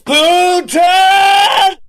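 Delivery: high shrieked name; mock shout. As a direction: yell the name like an accusation.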